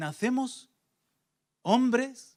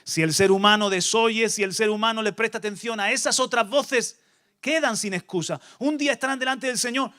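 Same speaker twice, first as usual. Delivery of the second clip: usual speaking voice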